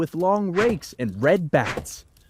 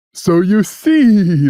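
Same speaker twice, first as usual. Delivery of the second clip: slow, much louder gloat; cat laughing; 11 dB louder, slower than usual. Acted. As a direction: savour the win with a laugh in it. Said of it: "laughed the cat".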